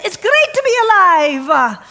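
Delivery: loud, high, slow joyful cry; exuberant. Retold or imitated. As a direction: shout it with full delight. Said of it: "And I said".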